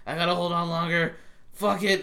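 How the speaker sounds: loud mock desperate resolve; straining to endure. Acted quote, Armin being burned.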